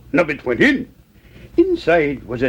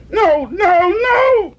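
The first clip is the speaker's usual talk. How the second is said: very high, louder wail; acted tearful cry; 5 dB louder, much slower than usual. A narrator's reading. Young mouse weeping at yet another no, crying out. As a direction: wail it out like an upset little one. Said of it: "cried Nubbet, weeping large-sized tears".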